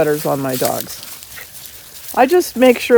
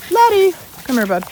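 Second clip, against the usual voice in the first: much higher coaxing call; high sing-song call to the dog; 10 semitones higher, 4 dB louder than usual. Real, not acted. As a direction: lift the voice high to coax the dog over. Calling dog again, higher.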